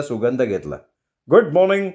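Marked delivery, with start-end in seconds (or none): 1.3-1.9 put-on a bright, higher, louder "good morning" from the young man; cheerful good morning. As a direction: greet them too cheerfully, as if nothing happened. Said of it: "Santosh आम्हाला बघून म्हणाला". (उंच, मोठ्या आवाजात उत्साही "good morning")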